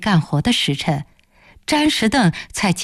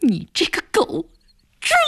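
higher, rasping curse; hoarse cry; 8 semitones higher than usual. Acted. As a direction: tear the words 你这个狗，猪 out hoarsely. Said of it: "暖暖嘶声地叫着". (嘶声叫出你这个狗，猪)

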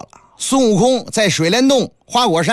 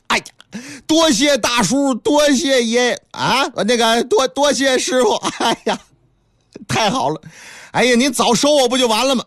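higher, bubbling thanks, fast at the end; eager monkey disciple; 6 semitones higher than usual. Acted. Wukong thanking his new master, higher eager monkey voice. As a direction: tumble over your words with glee.

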